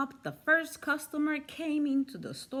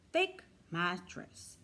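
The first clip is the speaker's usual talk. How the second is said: ordinary delivery, nothing marked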